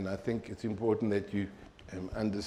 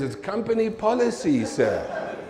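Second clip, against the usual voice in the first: high, louder, smug jobsworth voice with a laugh; mock officious clerk; 10 semitones higher, 4 dB louder than usual. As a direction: parrot the clerk's stock excuse mockingly. Mocking the supervisor's stock reply.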